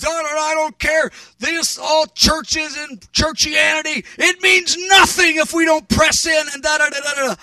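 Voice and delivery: high-pitched, urgent South African preacher; fiery South African accent. Retold or imitated. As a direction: imitate an impassioned South African exhorter. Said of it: "talking ... with energy and fire".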